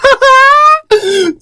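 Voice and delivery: real high-pitched cackle; sudden loud, high laugh; high squealing laugh. Real, not acted. Cracks up at own reading.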